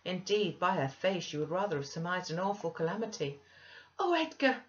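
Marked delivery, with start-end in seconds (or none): 4.0-4.6 very high, loud overjoyed cry; panted excitement. A narrator's reading. Wife rushing upstairs to her husband, excitedly panting. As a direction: burst in breathless with wild joy. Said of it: "she panted".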